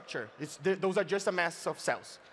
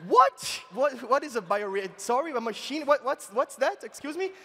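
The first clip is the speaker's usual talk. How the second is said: high, loud "what", then baffled questions; hammed-up disbelief, pitch leaping up; mock shock; 5 semitones higher, 4 dB louder than usual. Baffled crowd asking what a bioreactor is, mock shocked.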